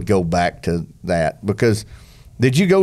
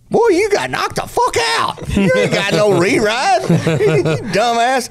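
mocking buddy; 14 semitones higher, slower than usual. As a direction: squeal it out like a buddy ribbing you. Ross mocks him in story.

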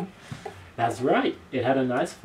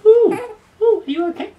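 startled ooh; 11 dB louder than usual. Real, not acted. Startled by baby.

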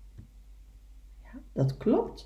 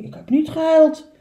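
high, loud denial; otter character voice; 7 semitones higher, 7 dB louder than usual. Acted. Otter answering little beaver's question, high loud voice. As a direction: deny it in a raised animal voice.

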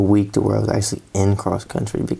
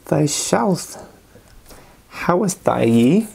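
lightly hammed-up Renaissance accent, slow and higher; mock Renaissance accent; 4 semitones higher, slower than usual. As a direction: fake Renaissance accent, playing at olde English. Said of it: "their accent from, like, the Renaissance time".

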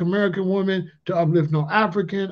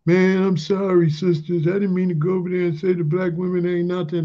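pleading apology; 3 semitones higher than usual. Retold or imitated. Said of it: "His cousin was copping a plea".

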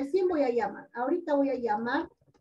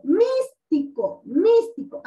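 "místico" with the first syllable "mís" punched and drawn out; slow, emphatic "místico", weight on the opening syllable; stress on MÍS; 4 semitones higher, 4 dB louder, slower than usual. Shown as a demonstration. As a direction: lean hard on "mís" in each "místico". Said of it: "la sílaba que tiene la mayor fuerza de voz".

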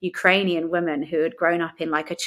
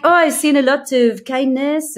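put-on French accent, higher and louder; mock French accent with raised voice; 7 semitones higher, 6 dB louder than usual. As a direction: imitate a French churchgoer, pitched up. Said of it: "were some French ones like".

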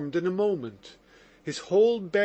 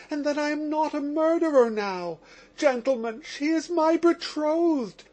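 high, rapturous and reverent, much higher voice; ecstatic, reverent; 8 semitones higher than usual. Acted. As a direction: pour it out in ecstatic relief, pitched up high. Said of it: "he said ecstatically and reverently".

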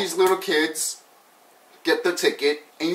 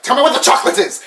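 demanding shout; 7 semitones higher, 5 dB louder, much faster than usual. Retold or imitated. Acting out pushy man demanding chocolate.

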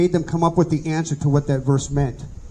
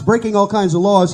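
ordinary talk, nothing marked

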